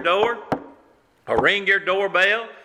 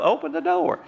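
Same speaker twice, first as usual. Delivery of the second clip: cheerful go-ahead; 11 semitones higher than usual. Retold or imitated. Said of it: "We tell her".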